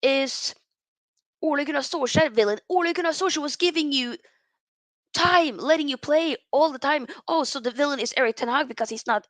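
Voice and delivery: normal talking voice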